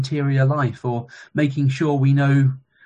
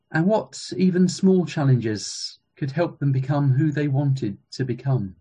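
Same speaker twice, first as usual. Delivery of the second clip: ordinary talk, nothing marked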